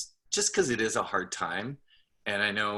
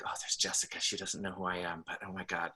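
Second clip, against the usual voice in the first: starstruck whisper; 2 semitones lower, 8 dB quieter, faster than usual. Retold or imitated. Starstruck inner voice at events.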